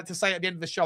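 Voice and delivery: normal talking voice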